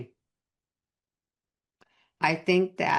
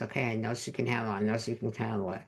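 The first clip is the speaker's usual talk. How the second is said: quiet quick reassurance; 4 semitones lower, 6 dB quieter, faster than usual. Retold or imitated. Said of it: "just sits back and just says".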